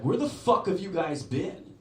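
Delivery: high, loud, drawn-out bro voice; demanding young bro. Retold or imitated. Teenage bro to late-arriving friends, loud demanding. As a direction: demand it loudly, stretching it out.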